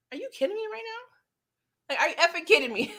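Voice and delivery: cocky disbelief. Retold or imitated. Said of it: "Teddy's old cocky behind was like".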